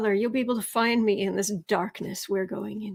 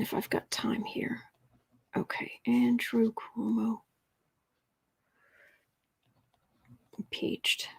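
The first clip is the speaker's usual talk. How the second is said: whisper; 6 dB quieter than usual. Real, not acted. Whispered aside while reading cards.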